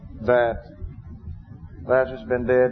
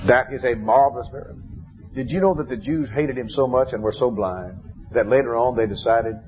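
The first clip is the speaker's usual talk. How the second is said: ordinary talk, nothing marked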